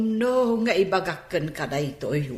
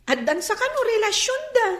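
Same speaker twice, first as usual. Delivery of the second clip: high, scandalized whisper-free gossip; excited gossip; 13 semitones higher, faster than usual. Acted. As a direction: pitch jumps way up with juicy news.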